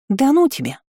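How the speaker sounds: high, flustered brush-off; embarrassed. Acted. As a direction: bashfully wave it away. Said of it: "смутилась подруга".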